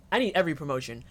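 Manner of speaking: ordinary delivery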